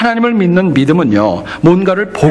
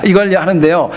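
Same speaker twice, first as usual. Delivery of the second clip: ordinary talk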